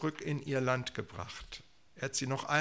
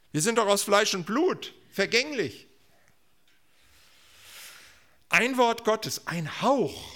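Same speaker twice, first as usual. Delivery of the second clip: high, loud exclamations; impassioned preaching; 9 semitones higher, 6 dB louder than usual. Real, not acted. Preacher protests that humans are perishable, impassioned. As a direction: punch out short, swooping phrases. Preacher voicing human frailty against God's word.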